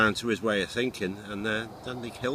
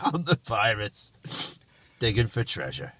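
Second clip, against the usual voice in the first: pirate voice. Pretend. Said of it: "in a pirate voice".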